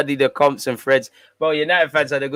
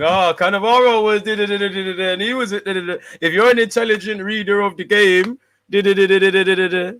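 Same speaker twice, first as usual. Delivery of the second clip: loud, higher-pitched blah-blah parody; mocking imitation; 6 semitones higher, 5 dB louder than usual. Streamer parodying what fans will say about Cannavaro, mocking imitation. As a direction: rattle off their argument as loud, dismissive nonsense. Mimics predicted arguments with nonsense syllables.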